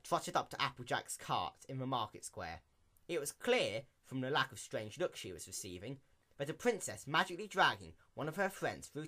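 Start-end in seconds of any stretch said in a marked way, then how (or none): none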